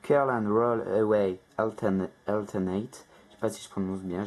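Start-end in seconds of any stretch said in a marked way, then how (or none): 0.0-3.1 English accent. Shown as a demonstration. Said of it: "j'essaye d'avoir un petit accent anglais".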